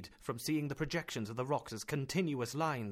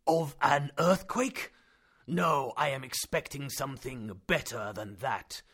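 slow and measured; calm relish; 5 dB louder, slower than usual. Acted. Uncle calm and eager.

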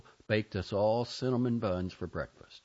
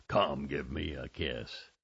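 low, slow growly uncle voice; drawn-out imitation of a growling uncle; restrained growl; 6 semitones lower, slower than usual. A narrator's reading. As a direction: growl it slowly without waking the house. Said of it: "I growled in his voice, being careful not to be so loud".

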